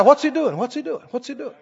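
ordinary talk

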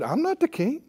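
a small, high voice of mild protest; balking underling. Acted. Secretary Bob answering his boss's order, balking.

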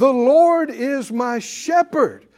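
slow preached declaration. Shown as a demonstration. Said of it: "Come on, say it again".

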